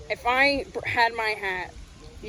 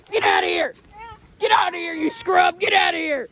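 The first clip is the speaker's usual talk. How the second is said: angry shouting; 7 semitones higher than usual. Acted. Chasing the pervert away from the family, furious shout. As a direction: drive him away with every shout.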